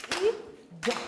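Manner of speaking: Pig split into its three sounds; sounds clapped out. Shown as a demonstration. Teacher to class, clapping the sounds of pig. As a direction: clap out each sound of pig. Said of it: "can you clap them".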